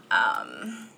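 creaky low um; vocal fry. Real, not acted. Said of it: "I've also got that vocal fry".

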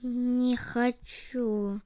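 a drowsy body groaning no; dragged-out sleepy moan; sleepy refusal. A narrator's reading. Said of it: "ответило тело".

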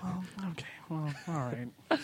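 whispering. Real, not acted. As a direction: whisper it, resigned. Whispered reply.

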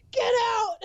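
high, loud yell with a cry in it; retold yell. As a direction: yell it to throw him out. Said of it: "Like, so loud at him".

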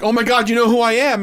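amazed disbelief. Retold or imitated. Fan amazed Jake knows him.

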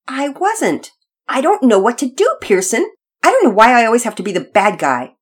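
put-on wounded, complaining child; whiny protest. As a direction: protest that you are being blamed unfairly. Sister protests being scolded.

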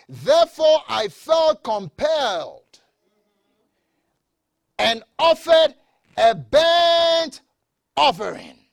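loud, high, stretched-out words; forceful scripture reading. Real, not acted. Preacher reading Saul's excuse to Samuel, forceful scripture reading. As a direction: declaim it slowly with heavy pauses.

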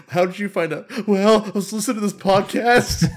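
high and loud, playful; mock kid telling a story. Future kid explaining how they found out, playful. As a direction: voice a child recounting it.

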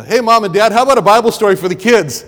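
high, bright call to passers-by. Retold or imitated. Evangelist calling parents over at the fair booth, loud.

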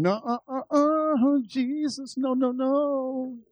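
high, drawn-out pleading no; high, slow, stubborn protesting no; whiny refusal. Retold or imitated. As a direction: whine no at God, stretching it out slowly. Arguing with God while singing.

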